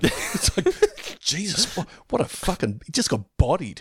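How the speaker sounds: ordinary talk, nothing marked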